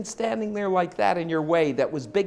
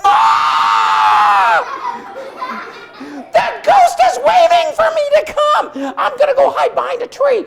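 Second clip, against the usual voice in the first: high, loud, slow frightened shout; terrified horse cry; 9 dB louder than usual. Acted. Horse cries out at the ghost in the doorway, high and loud. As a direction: wail in fear at the ghost.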